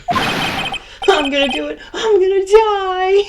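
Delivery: high, hammed-up tearful wail; melodramatic despair. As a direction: wail it out, drawing out the doom. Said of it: "it sounds like he wants to die".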